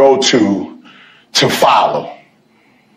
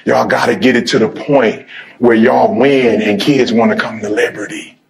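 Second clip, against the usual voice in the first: ordinary talk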